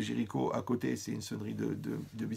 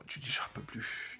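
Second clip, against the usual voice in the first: whispered, overstuffed and spent; whispering after a huge meal; exhausted whisper; 5 dB quieter than usual. Retold or imitated. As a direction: breathe it out like you ate too much. Overstuffed eater groaning after feast.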